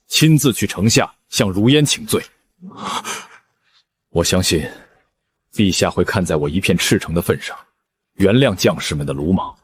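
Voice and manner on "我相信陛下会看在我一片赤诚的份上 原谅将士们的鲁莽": soft sincere. Acted. Lovesick general's sincere resolve.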